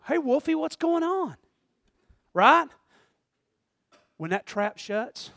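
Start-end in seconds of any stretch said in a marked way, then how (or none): 0.1-1.4 lightly voiced higher coaxing; a mock friendly call to a wild animal. Person about to pet a trapped coyote, coaxing.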